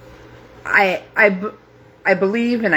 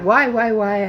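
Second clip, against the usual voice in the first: rising, pleading cry of why; retelling a desperate plea to God; anguished plea; 6 semitones higher than usual. Retold or imitated. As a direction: beg God for an answer, voice breaking upward. Pleading with God while grieving.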